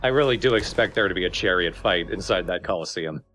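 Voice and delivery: ordinary delivery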